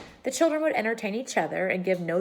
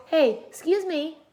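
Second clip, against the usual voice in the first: Pippi; 9 semitones higher, 4 dB louder, slower than usual. A narrator's reading. Pippi mouth full.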